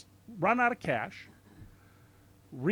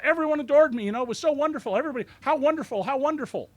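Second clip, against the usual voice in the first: higher, louder gushing self-praise; mock Trump boasting; 7 semitones higher, 4 dB louder, faster than usual. Mocking Trump's speech to the crowd, gushing higher and louder. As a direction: ham up the bragging like Trump.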